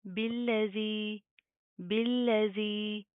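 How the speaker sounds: बिल्लज़ी said slowly, long ज़ी drawn out; slow recitation of बिल्लज़ी. Shown as a demonstration. Teacher reciting बिल्लज़ी slowly for students. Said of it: "खींच कर पढ़ेंगे".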